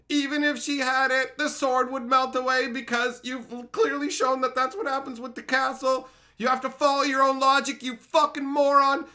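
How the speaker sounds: high, loud berating tirade; furious AI yelling. Pretend. Lore-checking AI screams at the writer, furious. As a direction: scream insults at a clueless author. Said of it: "it goes".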